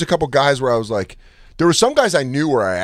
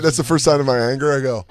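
ordinary talk, nothing marked

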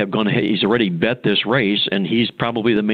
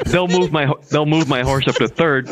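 words broken by laughter, pitched up; laughing through words; 6 semitones higher than usual. Retold or imitated. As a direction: laugh while retelling the plan. Laughing at his own scheme.

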